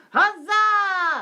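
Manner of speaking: loud, high, drawn-out hurrah; huzzah cheer. Shown as a demonstration. Host shows viewers what five dollars buys, a shouted huzzah. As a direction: shout a long celebratory cheer.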